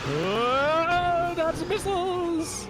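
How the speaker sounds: panicked shout. Real, not acted. Panicked call during game fight.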